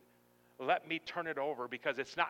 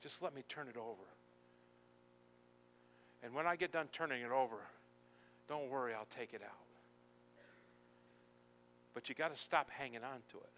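soft, gentle pleading with long pauses; hushed preaching; 5 semitones lower, 11 dB quieter than usual. Acted. God asking to turn the table over, hushed pleading. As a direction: drop right down and plead quietly.

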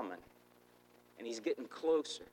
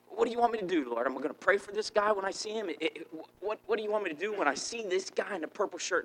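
eager, high-pitched questions to the Lord; excited questioning; 6 semitones higher, 4 dB louder, faster than usual. Retold or imitated. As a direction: act out urgent, loud wondering. Acting out anxious questions to God.

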